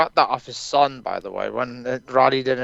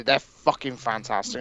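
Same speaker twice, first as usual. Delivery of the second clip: usual speaking voice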